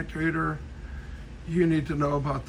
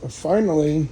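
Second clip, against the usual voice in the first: normal talking voice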